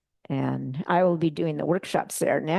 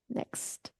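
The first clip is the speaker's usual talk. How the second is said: barely voiced aside to the helper; whispered cue; 15 dB quieter than usual. Real, not acted. Speaker quietly cues the slide operator, whispering. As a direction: breathe the word under your voice.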